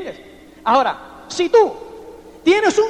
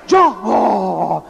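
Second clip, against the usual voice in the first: low, drawn-out sigh swelling into a boast; dreamy upline; 6 semitones lower, slower than usual. Retold or imitated. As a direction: ham up the overambitious upline's fantasy. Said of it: "me decía".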